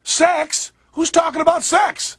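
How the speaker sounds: high-pitched feigned shock; mock surprise. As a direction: act scandalised, pitch jumping up. Feigned shock at sex mention.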